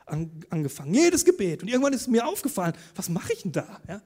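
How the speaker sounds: normal talking voice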